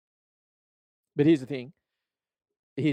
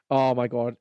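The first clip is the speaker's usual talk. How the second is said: normal talking voice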